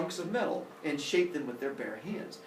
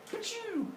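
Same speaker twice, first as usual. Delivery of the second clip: high, soft zap noise; sealing sound effect; 7 dB quieter than usual. Acted. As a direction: imitate a magical vanishing blast. Imitates the hole being sealed.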